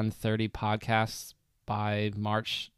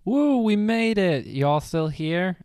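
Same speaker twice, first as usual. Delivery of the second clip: excited; 9 semitones higher, 5 dB louder, slower than usual. Real, not acted. Cheering after the transition.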